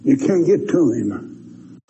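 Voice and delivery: ordinary delivery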